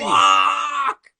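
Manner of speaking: high, loud startled curse; panicked yell. Retold or imitated. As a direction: yell it as the rickshaw crashes. Rickshaw crash, both yell.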